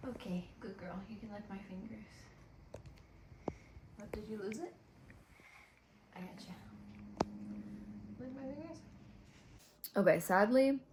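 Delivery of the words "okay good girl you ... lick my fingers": soft pet talk. Real, not acted. Softly talking to her cat.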